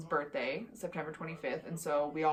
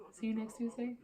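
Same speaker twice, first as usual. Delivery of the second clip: See you next Tuesday? lifted higher and softer, ending as a question; amused repeat; 5 semitones higher, 4 dB quieter than usual. Shown as a demonstration. Checking with viewers, higher and softer as a question. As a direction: toss the line back lightly, higher and quieter. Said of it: "Can't believe I've never said that".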